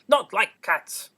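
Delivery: the mouse bursts out, offended and loud; shrill passionate cry. A narrator's reading. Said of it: "in a shrill, passionate voice".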